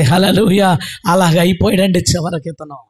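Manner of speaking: usual speaking voice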